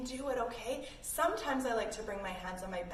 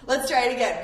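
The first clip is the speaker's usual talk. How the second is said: said loud through a laugh; 10 dB louder than usual. Real, not acted. Teacher still laughing, calling kids to retry. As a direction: still laughing as you speak.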